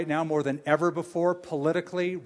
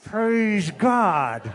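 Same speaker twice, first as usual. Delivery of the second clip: loud, raised-pitch put-on praise; forced rejoicing; 4 semitones higher, 5 dB louder than usual. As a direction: fake being thrilled for a rival. Acted begrudging reply to coworker's raise.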